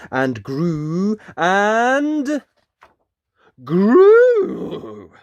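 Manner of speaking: climbing excitement, growing bigger and bigger; rising climax. A narrator's reading. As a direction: let the words swell until the final grew rings out. Magic swelling in story.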